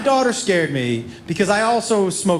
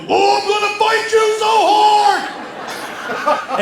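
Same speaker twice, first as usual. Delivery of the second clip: high, drawn-out mock yell; comically strained high-pitched holler; son's furious yell; 13 semitones higher, 4 dB louder, much slower than usual. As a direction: howl it as an outraged son, stretching every word. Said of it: "I could hear him go".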